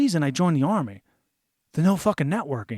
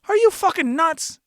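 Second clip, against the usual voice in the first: incredulous; 10 semitones higher, 4 dB louder than usual. Retold or imitated. Refusing to get back in.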